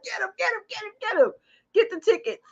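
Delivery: high, rapid-fire urging; urgent egging-on. Retold or imitated. Mom urging son to grab the tickets, excited. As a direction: re-enact urging a kid on, high-pitched.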